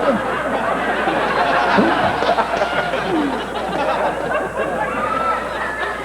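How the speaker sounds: wheezing high giggle; breathless high laugh. Real, not acted. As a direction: cackle breathlessly at the joke. Laughing at own bit.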